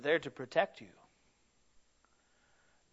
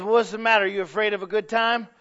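loud, coaxing peer-pressure taunt, higher; tempting fish voice; 5 semitones higher, 7 dB louder, faster than usual. Retold or imitated. Fish taunting a wary friend at the bait, loud and coaxing. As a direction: egg him on like a tempter fish. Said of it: "the other fish goes".

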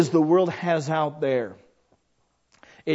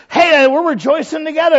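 higher and louder, joyful cheer; excited rejoicing; 10 semitones higher, 7 dB louder than usual. Acted. Preacher voicing believers sharing joy, excited. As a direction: celebrate out loud with friends.

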